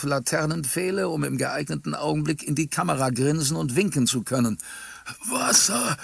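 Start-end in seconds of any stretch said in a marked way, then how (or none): 5.3-6.0 high, strained moan; groaning. A narrator's reading. Trapped driver begging the crowd for water, groaning. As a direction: moan it out like an injured man. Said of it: "hörte man den Fahrer abermals stöhnen".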